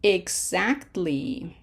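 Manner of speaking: "Exactly" very slowly, ex-act-ly in separate beats; teacher's slow syllable-by-syllable "Exactly"; broken into syllables. Shown as a demonstration. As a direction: sound out "Exactly" one syllable at a time. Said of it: "let's look at the next one".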